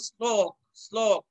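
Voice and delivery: slow শ্লোক opening on "sl"; careful sl- onset in শ্লোক; dental s sound. Shown as a demonstration. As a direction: say শ্লোক as slok, English s. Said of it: "দন্ত স এর উচ্চারণ".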